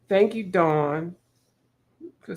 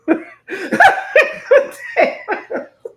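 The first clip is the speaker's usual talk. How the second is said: high shrieking laugh; 8 dB louder than usual. Real, not acted. Cracking up at friend's joke.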